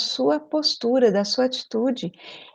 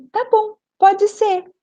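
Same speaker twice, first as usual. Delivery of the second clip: bright agreeing tone; 9 semitones higher, 4 dB louder than usual. Retold or imitated. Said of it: "Eu falei assim".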